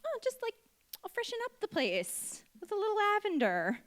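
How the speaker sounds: very high, airy-fairy mom voice; imitated mom. Mom sprinkling lavender to freshen the place, imitated breezy voice. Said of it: "my mom would just be like".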